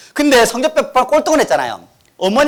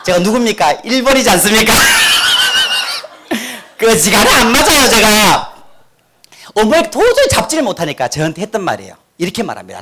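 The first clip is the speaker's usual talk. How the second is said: ordinary talk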